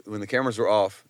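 normal talking voice

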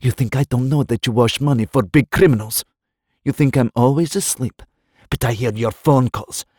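woman threatens her lover in rising fury; hissed rage. A narrator's reading. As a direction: threaten him through held-back tears. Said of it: "she'd hissed".

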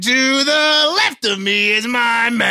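drawn-out hype intro, rising high at the end; radio show announcer. Acted. Host introducing the co-host on radio, hyped announcer. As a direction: stretch the co-host introduction out big.

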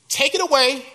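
first loud take of take it away; desperate plea. Acted. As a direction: cry it out to God. Paul pleading with God.

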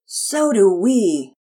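put-on slow, loud, longing boy; a kid wishing hard to keep the dog; longingly. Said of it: "Pearson said longingly".

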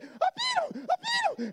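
very high squeal; squealing triumph. Retold or imitated. Winning son celebrating in a very high squeal. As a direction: squeal the win. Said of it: "I was just like".

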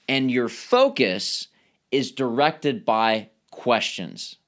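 usual speaking voice

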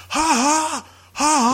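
high, forced "ha ha"; unhinged mock laugh. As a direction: imitate a man losing it with fake laughter. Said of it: "Like, lost his shit".

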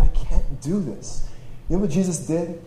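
usual speaking voice

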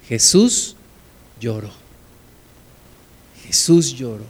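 soft, falling, with long pauses; solemn hush. Real, not acted. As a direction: let each word sink quietly. Shortest verse said in solemn hush.

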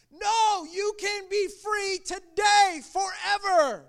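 high, loud preacher's cry; retold shout of fervent insistence; impassioned plea. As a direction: call it out urgently, drawing out the words. Retold urgent plea to crying man.

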